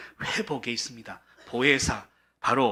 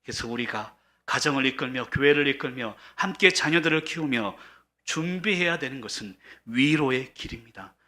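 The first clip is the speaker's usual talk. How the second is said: usual speaking voice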